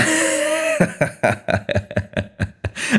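high, soft, tittering giggle. Acted. Host giggles teasingly at the prince. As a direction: titter coyly at your own cheek.

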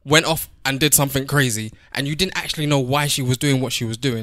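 ordinary talk, nothing marked

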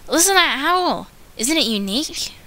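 put-on young wolf showing off a howl; Luna's voice. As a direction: swoop up proudly on howl.

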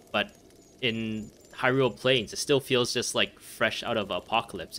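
normal talking voice

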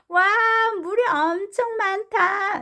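put-on high, drawn-out child's exclamation; slow baby-character cry; acted shout. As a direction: marvel aloud like an excited toddler. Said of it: "베이비 블레어가 소리쳤습니다".

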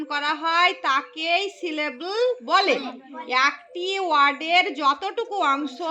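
slow, measured teacher delivery; slow dictation. Shown as a demonstration. Teacher giving pupils a definition to repeat, slow dictation. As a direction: spell it out phrase by phrase, slowly.

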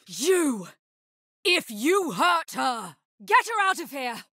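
threaten, then explode into a yell to get her out; angry outburst building to shout. Acted. Confronting an attacker, protecting her.

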